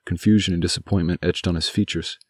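usual speaking voice

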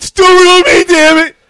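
a loud, high, hammed-up wrestling-promo shout; hype yell. Co-host hypes WrestleMania with a loud mock promo yell. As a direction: bellow it like a fired-up wrestling fan.

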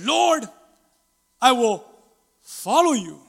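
loud, declamatory pledge in separate bursts; another would-be disciple. Acted. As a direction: cry out a solemn vow, pausing between phrases. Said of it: "And then another also said".

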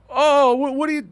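very high, squeaky and loud; high squeal of surprise; mock startled. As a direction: caught out, hammed-up panic. Mate caught out, squeaky.

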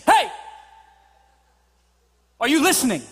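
sharp call, then a firm question; acted scolding parent. Parent to kids who tune out, acted stern check. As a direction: get a child's attention, then pause. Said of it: "you've said to your kids".

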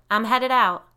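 high, projected call; yelling. A narrator's reading. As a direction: holler a goodbye. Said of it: "he yelled".

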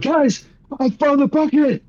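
very high, strained excited cry; mock-triumphant announcement. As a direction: burst in squealing news to your friends. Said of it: "Be like".

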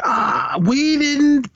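hammed-up fake denial; mock liar. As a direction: play the caught liar squirming. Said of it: "trying to lie".

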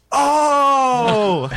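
loud, high, long-held "oh"; hammed-up shout as if it just dawned on you; exaggerated realization. Caught driver suddenly remembers the newspapers, hammed-up realization.

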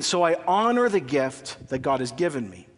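normal talking voice

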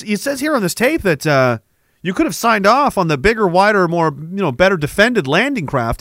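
ordinary talk

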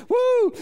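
high loud joyful woo; whoop. Real, not acted. Preacher whooping to the congregation, loud woo. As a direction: shout out with joy.

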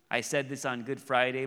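usual speaking voice

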